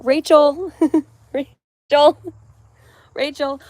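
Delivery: high, giggly Rachel; laughing Rachel. Real, not acted. Says name laughing.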